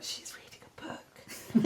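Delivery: slow secretive whisper. Retold or imitated. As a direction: whisper it like you're spying on them. Eavesdropping on strangers in bookshop.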